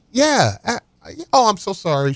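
high falsetto of an embarrassed mom; the mom suddenly apologizing; apologetic mom. Retold or imitated. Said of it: "She's like".

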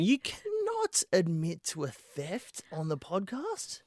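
slow, high mock disbelief; mock shocked. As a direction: act scandalised, stretch it out.

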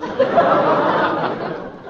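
breathy amused laugh; a short bright laugh. Acted. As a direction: laugh at his joke. Wife laughs at husband's joke.

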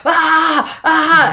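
playful high mock scream with a laugh. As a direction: scream for fun, laughing through it. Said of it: "I started going".

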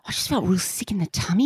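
put-on breathy, pitiful little-boy voice; whiny kid. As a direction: play the sick child begging for pity. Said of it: "son answering in car".

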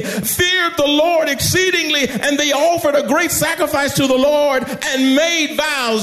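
high, ringing sermon delivery with drawn-out words; full-force preaching. Real, not acted. Preacher telling congregation of the rescued crewmen, full-force preaching. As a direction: preach it out in stretched, high phrases.

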